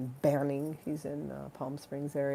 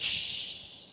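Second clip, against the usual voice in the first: shush; 5 dB quieter than usual. Real, not acted. Hushing the audience.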